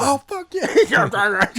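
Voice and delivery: high, slow, laughing mock cheer; squeaky hammed-up cheer. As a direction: squeal it out in a silly put-on voice. Acted line, high far voice.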